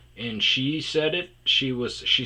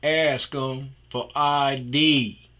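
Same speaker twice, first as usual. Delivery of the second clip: very slow, drawn-out, a bit louder; slow mock instruction; 3 semitones higher, 5 dB louder, much slower than usual. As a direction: spell it out like a sage giving advice. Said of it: "and he goes".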